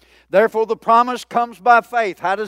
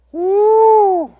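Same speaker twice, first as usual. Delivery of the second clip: high, loud whoop of joy; preacher's joyful whoop; excited woo; 11 semitones higher, 6 dB louder than usual. Real, not acted. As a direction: let out a celebratory shout. Excited shout mid-sermon.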